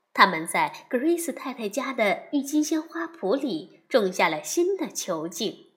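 usual speaking voice

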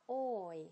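oi, oi with a clear glide from o to i; diphthong demo. Shown as a demonstration. Teacher repeats the sound for learners, clear glide. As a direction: repeat the diphthong like a model for students. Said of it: "从前面的一个音滑向另一个音".

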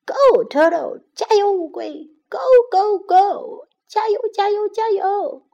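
high, loud crowd cheer. Acted. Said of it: "大声给乌龟加油".

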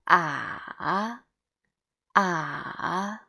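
long "ah" dipping down, then rising; valley-shaped "ah"; third tone. Shown as a demonstration. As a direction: sink "ah" and swoop it back up. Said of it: "read all together again from the beginning".

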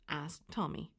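plain reading voice

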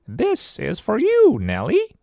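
squeaky high monster parent; Grit's voice. Acted. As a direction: pitch way up for Grit. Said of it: "said Grit".

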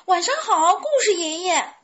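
high, loud, eager chick voice; eager clamouring. A narrator's reading. Chicks greeting Grandpa Story, eager clamouring. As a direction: pipe up loudly, jostling to be first. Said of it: "小鸡们争先恐后地说".

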